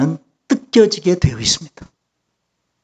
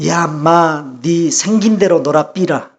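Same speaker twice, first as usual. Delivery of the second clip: Gyeongsang dialect; slower than usual. Retold or imitated. Pastor answering his wife's complaint, in blunt Gyeongsang dialect. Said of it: "경상도 사투리로".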